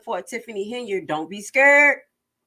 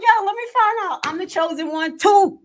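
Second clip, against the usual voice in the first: high, excited mock boast; crowing that she's the chosen one; 11 semitones higher, 4 dB louder than usual. Vlogger mocking the mayor's post, high and excited. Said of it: "I'm like".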